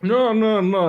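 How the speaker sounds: high, loud no-no-no; loud protest. Real, not acted. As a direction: cut in loudly in protest. Loud protest about meat paste.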